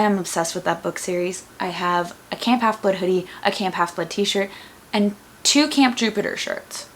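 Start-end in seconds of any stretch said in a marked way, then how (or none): none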